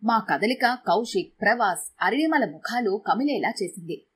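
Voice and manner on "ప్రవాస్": ordinary delivery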